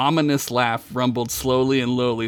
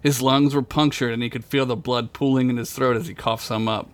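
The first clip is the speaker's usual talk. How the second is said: plain reading voice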